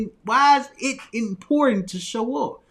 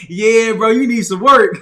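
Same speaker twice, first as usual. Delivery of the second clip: amused verdict; 11 dB louder than usual. Retold or imitated. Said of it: "He was like".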